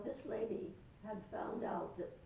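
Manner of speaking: ordinary delivery, nothing marked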